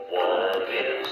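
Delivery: usual speaking voice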